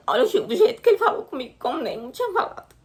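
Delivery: real crying, words pushed out high; weeping through the memory; crying while speaking. Real, not acted. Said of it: "Desculpa, gente". (chorando de verdade)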